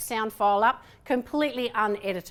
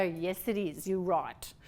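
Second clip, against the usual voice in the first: lower, softer, mock-defeated admission; sheepish concession; 8 semitones lower, 9 dB quieter than usual. Lecturer acts admitting her friend was right, sheepish low concession. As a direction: give in quietly, dropping the voice.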